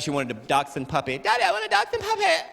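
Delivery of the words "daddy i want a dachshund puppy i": put-on very high squealing little-girl whine; little daughter begging. Daughter begging Daddy for a puppy, high mock squeal. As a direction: imitate a child's escalating, piercing nag. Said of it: "But my daughter, I know she's last year".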